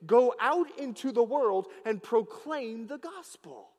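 loud, rising preacher's proclamation; full-force preaching. Acted. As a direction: preach it out to the whole church. Preaching to the congregation.

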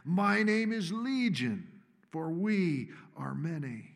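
slow, loud demonic bellow; growling demon bellow. A narrator's reading. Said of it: "The growling voice bellows its reply".

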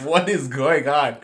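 high, laughing disbelief; mock disbelief. Reacting to his wife inviting the hiker, high disbelief. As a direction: squeal it in comic disbelief. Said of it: "I was like".

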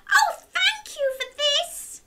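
very high, gushing grateful witch; delighted witch. Acted. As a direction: gush with thanks like a witch. Witch thanks Chloe.

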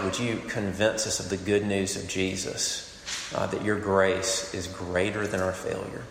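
quiet, unhurried spoken prayer; earnest prayer. Acted. Pastor prays aloud.

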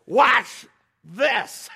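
loud, high call over the storm; big gleeful holler; excited showman's shout. Retold or imitated. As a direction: yell it like you're about to show off. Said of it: "It's kinda like".

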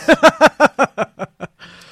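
big, high burst of laughing; loud laughter. Real, not acted. Laughing at joke.

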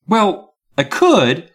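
high, drawn-out hedging; slow reply. A narrator's reading. Miss Carmen hedging about the transfer, slowly. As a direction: stretch it out reluctantly. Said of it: "she replied slowly".

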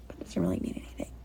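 soft breathy aside; whisper. Real, not acted. Reader whispers an aside to the child. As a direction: drop to a whisper, just for the listener.

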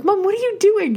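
high, loud disbelieving squeal; shocked at mom's plan, voice shooting up; squealing in disbelief at mom; incredulous. Retold or imitated. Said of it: "I'm like".

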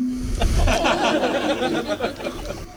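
real, soft laughter at her own story; quiet chuckle after the charge; laughing. Real, not acted. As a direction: laugh it off, embarrassed and amused.

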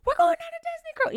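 thrilled squeal. Retold or imitated. Imagined thrilled daughter reaction.

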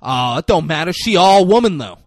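loud, hammed-up leering brag; lewd guy impression. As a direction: crow it out as the shameless thug. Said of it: "And this guy said".

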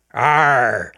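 loud growled R, pirate style; Irish pirate R. Shown as a demonstration. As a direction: growl the R from the back of the mouth. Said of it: "from being a Scotsman to an Irishman".

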